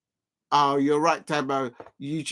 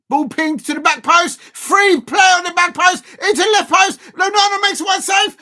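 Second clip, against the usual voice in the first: much higher and louder, frantic play-by-play yell; excited shout; 10 semitones higher, 9 dB louder than usual. Real, not acted. Fan calling a goalmouth scramble, frantic excited shout. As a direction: shout every touch of the scramble.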